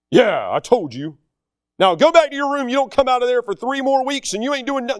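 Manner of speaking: mock parental tirade, rising high; imitating a dad laying down punishment; scolding dad. Dad sending son back to his room, mock scolding.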